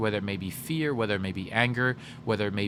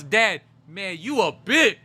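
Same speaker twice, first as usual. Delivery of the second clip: higher and louder, rising to a yell on "bitch"; cheeky taunt; 7 semitones higher, 6 dB louder, slower than usual. Retold or imitated. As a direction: throw the insult over your shoulder while fleeing. Retold taunt at dad, running off.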